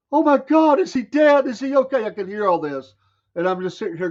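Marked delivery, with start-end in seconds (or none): 0.1-2.0 high, loud frantic cries; panicked women. Retold or imitated. Neighbor women panicking over hurt boy, shrill cries. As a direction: shriek it like frightened neighbor women. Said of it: "The women screaming, crying".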